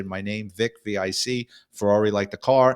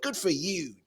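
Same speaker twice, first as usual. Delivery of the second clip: high-pitched teasing sitcom impression; exaggerated high character voice; John Lovitz; 7 semitones higher, slower than usual. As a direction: mimic the comedian's sitcom line, pitched up. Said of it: "when he was in Seinfeld".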